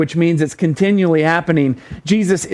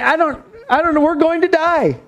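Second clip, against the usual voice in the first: high-pitched mock panic; acted freaking out; 9 semitones higher than usual. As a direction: play a servant sure of doom, pitched well up. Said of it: "he's freaking out".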